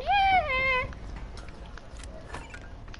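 long high-pitched cackle; high laugh. Real, not acted. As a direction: let out a drawn-out, high laugh. Player laughs after a kill.

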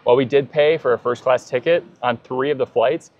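ordinary talk

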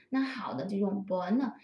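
usual speaking voice

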